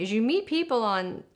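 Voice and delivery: ordinary talk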